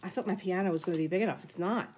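normal talking voice

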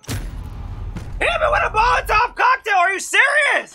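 very high, incredulous yell; outraged. Real, not acted. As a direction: shriek in disbelief at a cheap kill. Outraged at being hit in game.

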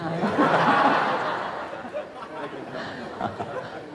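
long breathy laugh, trailing down; long airy laugh. Real, not acted. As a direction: laugh it off at your own blunt remark. Laughing at own remark.

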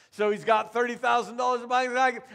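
normal talking voice

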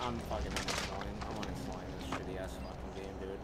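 very soft, low grumble; defeated muttering. Real, not acted. Gamer threatening to uninstall the game, muttering. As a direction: mutter it under your breath.

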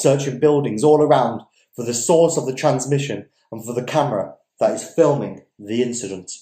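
measured literary reading voice; steady, deliberate storyteller read; story narration. A narrator's reading. Reading a novel chapter aloud.

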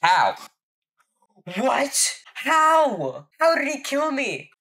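high, loud outraged disbelief; raging gamer. Real, not acted. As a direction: shriek it in disbelief after dying. Dies suddenly to enemy player.

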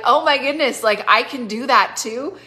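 excitedly voicing someone else's surprise; excited discovery. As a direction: act out a thrilled realization. Voicing listener's delighted discovery.

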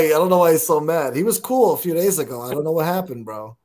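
ordinary talk, nothing marked

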